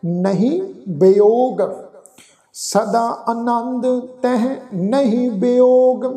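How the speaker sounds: slow, measured sacred verse; scripture recitation. Shown as a demonstration. Teacher reciting Gurbani for the congregation, slow recitation. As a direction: recite slowly, drawing out the long vowels.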